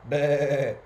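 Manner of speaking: loud, wavering baa; sheep bleating. A narrator's reading. Storyteller voicing the new sheep, bleating. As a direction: imitate a sheep with one bleat. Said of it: "He heard the sheep going".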